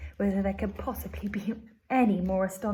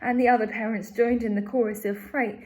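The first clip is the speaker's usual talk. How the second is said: plain reading voice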